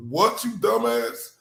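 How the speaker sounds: ordinary delivery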